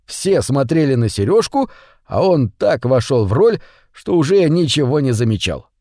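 usual speaking voice

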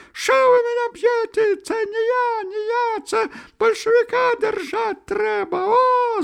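very high falsetto protest; frantic, piercing high howl; thin-voiced wail. A narrator's reading. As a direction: squeal in protest while being beaten. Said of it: "завопил он".

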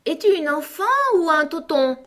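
high, loud, sharp question; scolding sheep. A narrator's reading. Sheep to spinning Alice, scolding.

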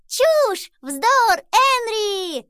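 very high, loud excited squeals; high-pitched gleeful cries; delighted shouting. A narrator's reading. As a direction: shriek with joy like excited little creatures. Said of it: "восторженно кричали они".

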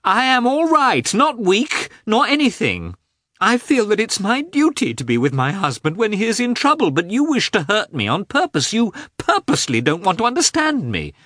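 very high, wounded outburst; distraught wife, angry and near tears; tearful resentment. A narrator's reading. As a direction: protest bitterly at the top of the voice's pitch. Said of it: "tears of anger and resentment".

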